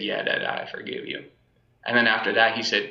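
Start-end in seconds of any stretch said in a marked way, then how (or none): 0.0-1.2 barely voiced little boy's answer; soft child's reply. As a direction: hush it like a small boy forgiving. Son's reply, quoted by father.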